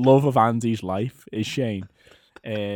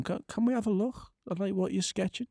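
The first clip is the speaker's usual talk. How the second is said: a lightly voiced, higher, soft interviewer asking gently; a hint of a timid cameraman, higher and softer; imitating the interviewer; 8 semitones higher, 5 dB quieter than usual. Mimicking the interviewer asking Andy about his sketch, soft and higher. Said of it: "behind the camera's going".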